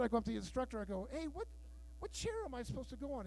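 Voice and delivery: usual speaking voice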